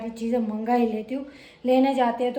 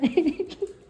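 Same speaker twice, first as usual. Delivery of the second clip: a bright, high burst of laughter; real laugh; 7 semitones higher than usual. Real, not acted. Laughing at herself over a basket of snacks. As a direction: laugh at her own shopping spree. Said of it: "ये देखो आज मुझे हंसी आ रही है".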